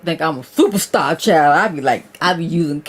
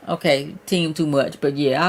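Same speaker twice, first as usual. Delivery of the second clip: normal talking voice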